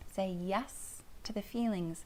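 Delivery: usual speaking voice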